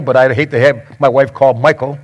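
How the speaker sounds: normal talking voice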